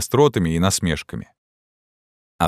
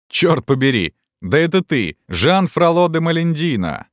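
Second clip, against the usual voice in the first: high, raised cry of surprise; shouted greeting; 10 semitones higher, 3 dB louder than usual. A narrator's reading. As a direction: call out to a friend across the hall. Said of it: "крикнул один из них".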